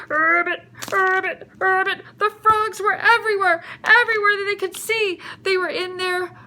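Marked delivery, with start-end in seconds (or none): 0.1-2.0 rolled, throaty ribbit; frog croak. Acted. Storyteller voices the frog from the river, croaking. Imitates millions of frogs.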